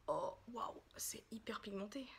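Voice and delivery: hushed, breath-only amazement; soft, low gasp of awe; awed whisper. Real, not acted. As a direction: whisper it in wonder. Awed by the first swatch.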